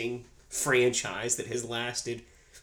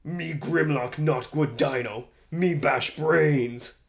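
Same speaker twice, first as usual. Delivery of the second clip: slow, loud caveman dinosaur robot; roaring grunting brute; 2 semitones higher, 6 dB louder, much slower than usual. Retold or imitated. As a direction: bellow it slowly like a dim brute. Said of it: "When we quote this movie".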